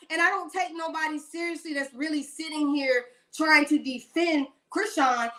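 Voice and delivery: ordinary delivery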